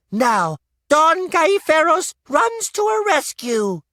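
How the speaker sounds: put-on a boy presenting a puppet show; young boy narrator. A narrator's reading. Boy introducing the puppet show to the inn crowd, high and loud.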